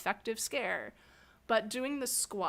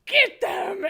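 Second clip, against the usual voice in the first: much higher and louder, a desperate yell; scare command from the floor; 12 semitones higher, 10 dB louder than usual. Retold or imitated. Fallen scare actor yelling at her partner to chase the guests, high and loud. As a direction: shout the order while sprawled on the floor. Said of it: "and I'm like".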